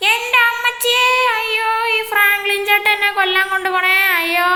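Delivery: high, loud sing-song wail for help; hammed-up panicked crying out; mock wailing. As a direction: wail like a kid being dragged off. Kid dragged off, wailing for help.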